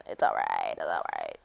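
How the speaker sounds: very low creaky voice; low creaky self-reassurance. Real, not acted. Reassures self after botched take.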